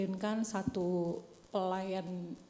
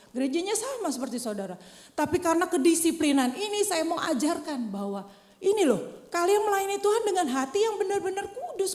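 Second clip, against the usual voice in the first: loud, higher fervent sermon; preaching at full force; 8 semitones higher, 5 dB louder than usual. Acted. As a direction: drive it home to the church. (berkhotbah dengan penuh semangat)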